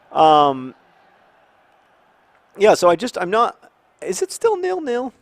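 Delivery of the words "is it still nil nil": surprised. Real, not acted. Surprised at the score.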